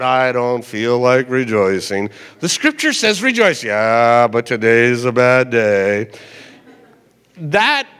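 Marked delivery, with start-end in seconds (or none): 0.0-2.1 much lower, glum grumble; melancholy person. Retold or imitated. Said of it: "people who are kind of melancholy". 3.6-6.1 much lower and slow, gloomy; low slow gloomy mock whine; melancholy person. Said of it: "people who are kind of melancholy".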